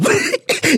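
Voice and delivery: short squeaky laugh; high burst of giggles. Real, not acted. Still laughing.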